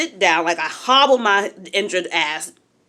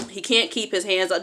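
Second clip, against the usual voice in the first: normal talking voice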